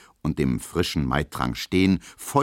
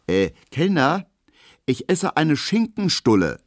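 high, louder call to the waiter; 10 semitones higher, 5 dB louder than usual. Acted. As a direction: call out quickly before the girls order. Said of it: "ruft Emil Kubinke schnell". (Emil ruft hastig laut nach dem Kellner)

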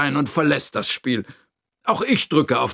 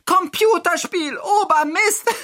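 very high yell breaking into laughter; roar and laugh. Acted. Hira outdoes the friends, high roar then laughter. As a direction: bellow it, then burst out laughing. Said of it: "brüllt Hira und lacht".

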